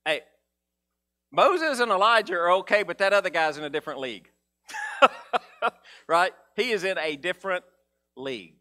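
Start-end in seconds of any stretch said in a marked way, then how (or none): 4.7-5.4 breathy laugh. Real, not acted. Laugh after joke.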